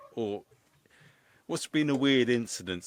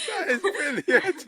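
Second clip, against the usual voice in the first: high, slow, laughing; 7 dB louder, slower than usual. Real, not acted. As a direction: laugh out the words. Laughing reaction.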